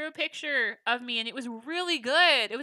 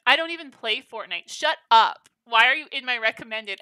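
louder, snippy read of a rude comment; mock angry commenter; 9 dB louder than usual. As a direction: act out the angry commenter's rant, lightly. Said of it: "the other comment was from Mario Fan".

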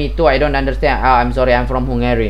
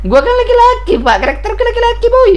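very high squeaky complaint; shrill mock-outraged whine; outraged protest; 5 dB louder than usual. Real, not acted. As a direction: insist loudly at the game. Protesting wrong character gender.

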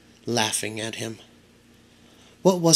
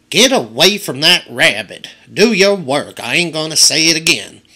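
put-on scolding old woman; 3 semitones higher, 5 dB louder than usual. A narrator's reading. Old woman scolds Bryce again.

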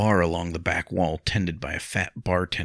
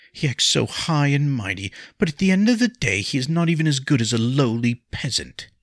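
high, loud contempt; scornful; 9 semitones higher, 4 dB louder than usual. A narrator's reading. Wife scorning her high and mighty husband, bitter. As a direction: run him down with raised voice.

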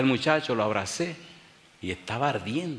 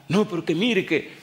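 high, anxious woman's voice; an alarmed lady warning the pastor; woman pleading; 8 semitones higher, 6 dB louder than usual. Retold or imitated. Lady urgently warns pastor away from sick son, high pleading.

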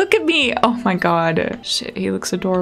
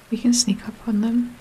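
unvoiced sneaky whisper; 4 dB quieter than usual. Real, not acted. Sneaking in game.

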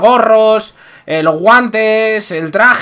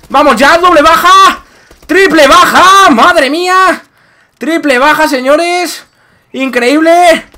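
raging gamer; 8 semitones higher, 5 dB louder, slower than usual. Real, not acted. Multi-kill celebration.

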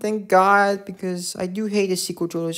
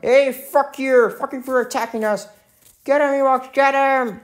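raised, loud mock battle cry as an Ewok; Ewok impression; 5 semitones higher, 4 dB louder than usual. Ewoks rallying against attacking Stormtroopers, mock angry shout. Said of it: "my perfect Ewok impression".

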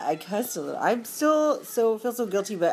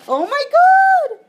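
much higher, much louder, slowed wail; drawn-out horrified cry; dramatic shriek; 11 dB louder, much slower than usual. Real, not acted. Vlogger mortified at his own swearing, shrieking. Horrified at having sworn on camera.

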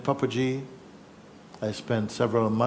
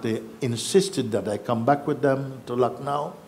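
normal talking voice

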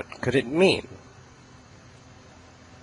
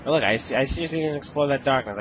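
usual speaking voice

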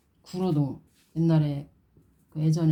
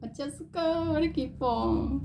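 cutesy aegyo; 8 semitones higher than usual. Real, not acted. Cooing to the dolls, high aegyo baby talk. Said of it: "애교 장난 아니게".

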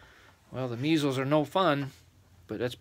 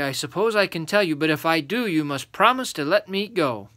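put-on raised little-boy voice; bargaining boy; 5 semitones higher, 6 dB louder than usual. Little boy bargaining with three giants, insistent. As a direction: set your condition as a little boy.

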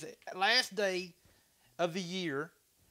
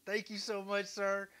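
normal talking voice

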